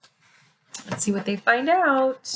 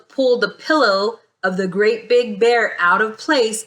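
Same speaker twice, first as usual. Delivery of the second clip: ordinary delivery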